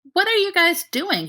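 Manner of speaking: high, eager puppy voice; dog-like curious. Acted. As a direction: yap it out like an excited puppy. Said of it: "he inquires, abruptly extremely curious".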